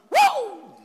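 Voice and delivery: high, loud "woo"; triumphant whoop. Acted. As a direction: let out one big victory whoop. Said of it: "got up and went".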